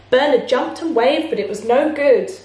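usual speaking voice

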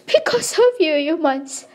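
real sob on the words; crying. Real, not acted. Tearful accusation of humans.

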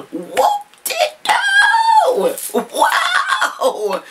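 very high, slow, shouted disbelief; astonished shout. Real, not acted. Discovering a friend's extra gift, astonished shout. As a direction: cry out in happy disbelief.

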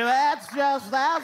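lightly voiced flustered female objection; mock disapproving woman. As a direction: imitate a woman starting to scold. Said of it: "That's women's jobs to say".

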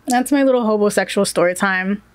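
ordinary talk

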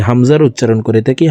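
ordinary talk

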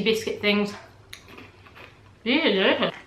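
talking around a cracker; chewing between words; mouth full, chewing. Real, not acted. Seen 3: Bites a cracker at -2.0; jaw still working early in the words.

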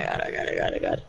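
whispered panicked oh god; whispering. Real, not acted.

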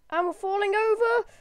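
high, loud cry of alarm; alarmed shout. Real, not acted. Player watching his moon lander topple, alarmed shout.

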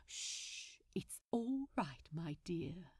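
hushed, soothing whisper. Acted. Aziraphale soothing his captive in a whisper. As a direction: whisper it to calm him.

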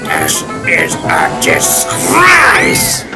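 very slow, furious growl. Acted. Alfred fuming over his empty trains, growling. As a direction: growl it out word by word. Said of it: "growled Alfred".

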